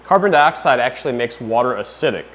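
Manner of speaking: ordinary talk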